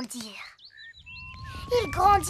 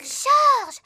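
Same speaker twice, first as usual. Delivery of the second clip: sharp loud call of a name, higher; scolding call; 7 semitones higher, 7 dB louder than usual. Acted. Big sister scolding her little brother, loud sharp call. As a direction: call his name out loud to tell him off.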